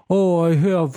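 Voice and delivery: mock Russian accent. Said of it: "he's like".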